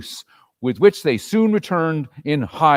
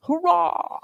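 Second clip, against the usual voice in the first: thin, high little cheer; a weak child's cheer, pitched up; feeble cry. A narrator's reading. Tiny Tim's small cheer at the table. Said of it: "feebly cried".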